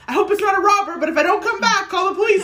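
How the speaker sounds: high, loud mock warning; joking alarm. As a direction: call it out loudly, half in jest. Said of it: "Like, just joking".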